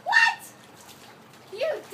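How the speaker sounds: high, loud disbelieving what; shocked squeal. Real, not acted. Friend hears her videos are online, shocked squeal.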